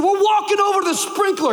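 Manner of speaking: high, loud command; march him there with your voice; firm shout. Retold or imitated. Dad telling his son they are going to the sprinkler, loud and firm. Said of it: "I said".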